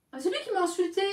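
sudden self-justifying exclamation; indignant realisation. Real, not acted. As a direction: pitch it up as it comes back to you. Realises who insulted whom, protests.

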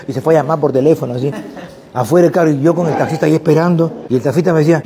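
ordinary talk

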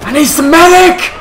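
high urgent call for medic; man down shout. Real, not acted. Calling for a medic.

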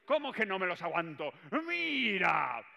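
high, loud indignant shout; defiant shout. Acted. Boss demanding his staff watch, high loud indignant shout. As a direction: yell the challenge.

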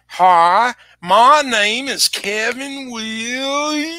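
put-on long drawn-out Southern Illinois accent, last word stretched right out; Kevin Williams. New acquaintance from Southern Illinois introducing himself, exaggerated drawl. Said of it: "Kevin talked like this".

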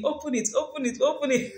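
high teasing laugh through words; playful laughing. Real, not acted. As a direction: coax them playfully, laughing. Teasing camera, offering food.

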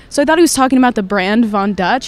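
normal talking voice

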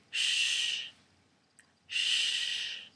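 "Sh" as a soft hiss, teeth apart; sh sound. Shown as a demonstration. Teacher showing sh, not ch. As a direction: hush like asking for silence. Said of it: "queda un poquito de espacio".

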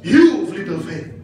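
stern rebuke. Shown as a demonstration. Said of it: "Parang pagalit na sinabi".

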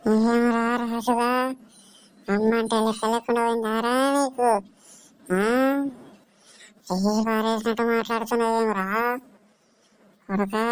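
ghostly, stretched-out scolding; feigned possession. Pretend. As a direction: drag out the line endings like a possessed woman. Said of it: "దయ్యం ఇట్లా పట్టిందా ఏం?".

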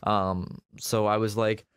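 normal talking voice

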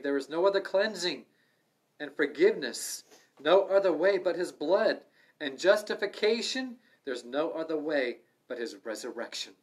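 fiery pulpit emphasis; forceful preaching. Acted. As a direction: preach it out hard to the room.

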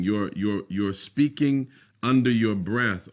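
normal talking voice

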